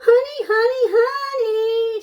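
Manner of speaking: high, loud teasing call; sing-song bird call. Acted. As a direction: lure him in, singing out high. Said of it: "she cried, grinning".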